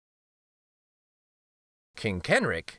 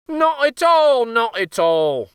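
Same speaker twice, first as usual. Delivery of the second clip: breezy uncle; 11 semitones higher, 7 dB louder than usual. A narrator's reading. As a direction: shrug it off. Uncle voiced in story.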